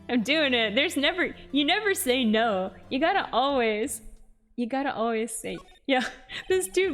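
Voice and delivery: ordinary talk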